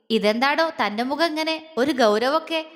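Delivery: plain reading voice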